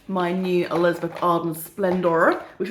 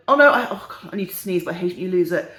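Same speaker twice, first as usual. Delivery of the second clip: ordinary talk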